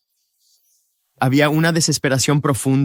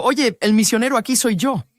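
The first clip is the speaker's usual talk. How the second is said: defensive and proud, pitched higher; defensive retort; 7 semitones higher than usual. Retold or imitated. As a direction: snap back, wounded pride. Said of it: "me pongo a la defensiva".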